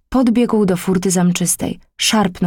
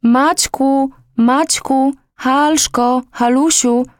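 calling out; 6 semitones higher, much slower than usual. Acted. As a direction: call the children's names from afar. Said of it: "rozległo się nawoływanie z ulicy".

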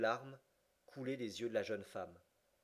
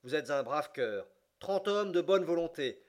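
rallying call; 4 semitones higher, 8 dB louder than usual. A narrator's reading. Captain rallies his soldiers.

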